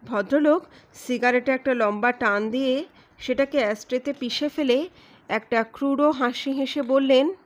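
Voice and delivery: usual speaking voice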